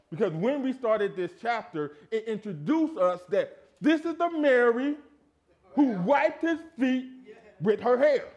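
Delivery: loud, high, stretched-out phrases; full-force preaching. Acted. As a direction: hammer it home from the pulpit.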